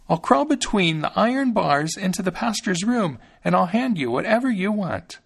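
put-on high, small voice of a thumb-sized boy; little Thumbling, fretful; Thumbling's tiny voice. A narrator's reading. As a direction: pitch it up like a tiny hero.

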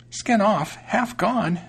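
high-pitched mouse pondering odd names; wondering mouse. A narrator's reading. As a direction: repeat the names high and puzzled.

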